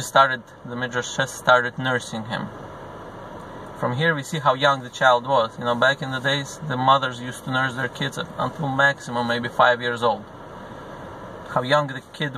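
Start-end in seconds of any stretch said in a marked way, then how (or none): none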